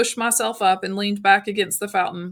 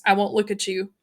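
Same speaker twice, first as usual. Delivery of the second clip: plain reading voice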